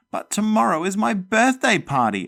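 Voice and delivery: high, loud dismayed wail. Acted. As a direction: whine it out in despair. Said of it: "I wailed".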